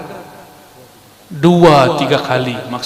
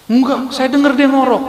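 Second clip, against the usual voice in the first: insistent friend; 9 semitones higher, 4 dB louder than usual. Retold or imitated. Friend insists he heard snoring.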